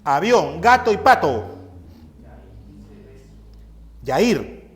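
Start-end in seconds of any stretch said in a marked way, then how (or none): none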